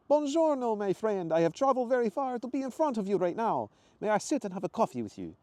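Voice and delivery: high, loud Italian greeting; excited Italian accent. Acted. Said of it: "he said excitedly, his faded Italian accent".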